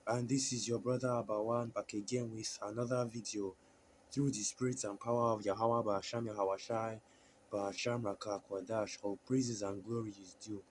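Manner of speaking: usual speaking voice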